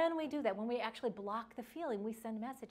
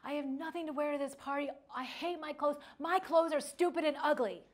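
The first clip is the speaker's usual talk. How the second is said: put-on higher, whiny child's complaint building louder; mock childish tantrum over clothes; pouting daughter; 5 semitones higher, 6 dB louder than usual. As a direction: act out an upset ten-year-old, building to a louder outburst. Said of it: "she's pouting, she's crying, she's like".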